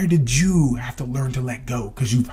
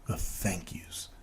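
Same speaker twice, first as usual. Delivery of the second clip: slow, breathy whisper of the last words; slow hushed whisper about craving gratitude; 5 semitones lower, 13 dB quieter, slower than usual. Real, not acted. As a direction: let the words drop to a slow whisper, savouring them. Dramatic hushed ending of a phrase.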